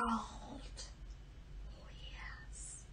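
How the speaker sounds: hushed, thinking-aloud whisper; whispered musing. Acted. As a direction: mutter it to yourself. Daughter sighs to herself.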